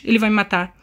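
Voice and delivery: ordinary talk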